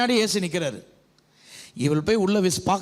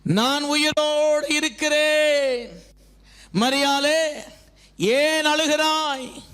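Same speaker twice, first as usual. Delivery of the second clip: slow, high, long-sustained declamation; preaching proclamation; 8 semitones higher, slower than usual. Acted. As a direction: proclaim it as Christ's own promise, stretching every phrase. Proclaims Jesus' words to congregation. (நீட்டி முழங்கும் பிரசங்க அறிவிப்பு)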